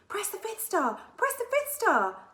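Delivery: high, loud urgent shout, slowing on the repeat; Cassandra shouting urgently from her seat. A narrator's reading. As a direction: yell the order before it's too late. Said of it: "Cassandra shouted urgently".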